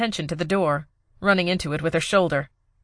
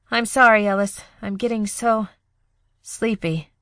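higher, fading sleepy voice; drowsy goodbye; 5 semitones higher than usual. Acted. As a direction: slip away while apologizing.

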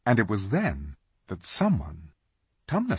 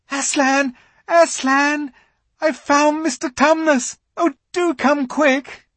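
put-on high, loud joyful girl's cry; excited shouting; 7 dB louder than usual. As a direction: shout the happy news. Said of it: "came rushing upstairs shouting out".

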